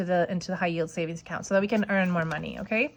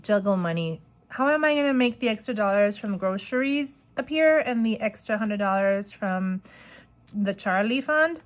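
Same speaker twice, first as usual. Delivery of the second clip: normal talking voice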